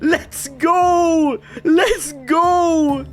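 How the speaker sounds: high, drawn-out celebratory cry; triumphant cheer. Real, not acted. Player celebrating Tangiers reconquered, triumphant cheer.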